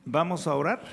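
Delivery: normal talking voice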